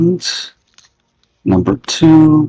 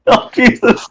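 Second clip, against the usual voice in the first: laughing; 10 semitones higher, slower than usual. Real, not acted. After bandit faints.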